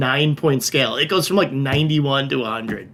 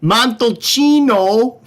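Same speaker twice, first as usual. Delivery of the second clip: put-on slow, higher Wisconsin accent on Italian names; 8 semitones higher, 3 dB louder, much slower than usual. Viewer request, Italian regions in slow hammy Wisconsin accent. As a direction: stretch each town in a goofy Wisconsin drawl. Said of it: "with a Wisconsin accent".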